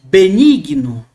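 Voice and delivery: benigno stretched slow, the E held; long E. Shown as a demonstration. Teacher illustrating eta as a longer E, drawn out. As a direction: linger on the E of benigno. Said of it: "um eta mais longo".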